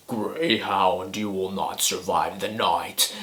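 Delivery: low, slow menacing voice; scary scarecrow voice. Retold or imitated. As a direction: creep out the listener, slow and low. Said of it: "that scarecrow scary voice".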